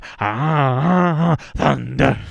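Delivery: loud hammed-up rock radio yell; loud mock thunder cry; mock radio blast. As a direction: belt it like a blaring station. Imitating loud radio sound.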